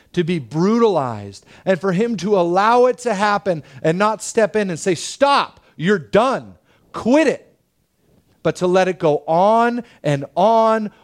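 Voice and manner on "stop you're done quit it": sharp, high-pitched order to halt; forceful command. Acted. Imagined Father intervening against torturers, commanding. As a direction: step in and shut it down hard.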